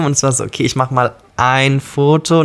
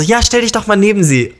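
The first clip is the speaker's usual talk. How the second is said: security guard; 9 semitones higher than usual. Retold or imitated.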